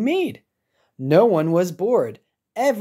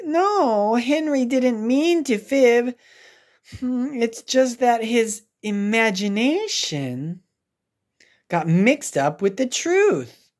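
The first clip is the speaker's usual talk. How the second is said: gentle teacher; 7 semitones higher than usual. Acted. Teacher defends Henry.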